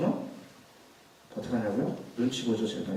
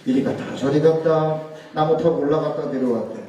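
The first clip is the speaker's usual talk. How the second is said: ordinary talk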